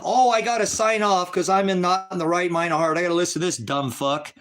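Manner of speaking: high, loud mocking outburst easing down; sarcastic mock compliance. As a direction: feign obedience in a high, scornful voice. Mockingly echoes order to sign off.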